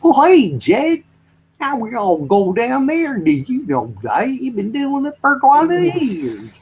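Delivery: high, slow hillbilly drawl; Beverly Hillbillies voice. Retold or imitated. Mimicking the Beverly Hillbillies in Kentucky, high slow drawl. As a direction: drag each word out like a backwoods Kentuckian. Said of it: "Beverly Hillbillies ... they'll go".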